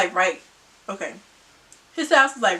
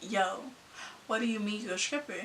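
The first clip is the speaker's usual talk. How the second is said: much softer, fast and curt; cold and quiet; 3 semitones lower, 16 dB quieter than usual. Retold or imitated. On the phone to boyfriend at night, cold quiet mutter. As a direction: mutter it like you don't want to talk. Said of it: "did not wanna talk".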